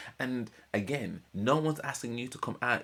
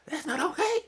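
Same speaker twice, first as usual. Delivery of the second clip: very high, strained Marge voice, partly whispered; Marge Simpson impression; 13 semitones higher than usual. Telling the Simpsons plot, voicing Marge scolding Homer. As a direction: pitch way up and squeeze like Marge. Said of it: "That's my best Marge Simpson impression".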